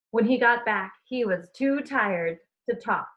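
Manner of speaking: ordinary delivery, nothing marked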